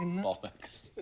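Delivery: soft Australian-accented bollocks; a hint of an Aussie swearing. Shown as a demonstration. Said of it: "That was an Australian".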